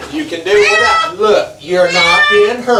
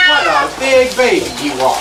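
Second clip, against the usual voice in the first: usual speaking voice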